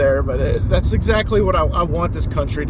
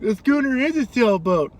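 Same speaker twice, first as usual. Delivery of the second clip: put-on high, chirpy kid voice; lightly voiced excited kid; mock little kid; 8 semitones higher than usual. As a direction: point it out like an eager child. Kid voiced in film retelling.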